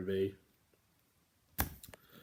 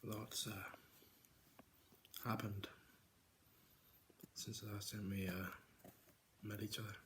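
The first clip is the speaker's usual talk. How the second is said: dramatic hushed confession; 6 dB quieter than usual. Pretend. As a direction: lean in and murmur it like a secret. Dramatic quiet vlog intro.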